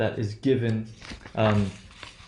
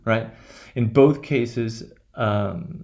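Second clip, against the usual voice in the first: ordinary talk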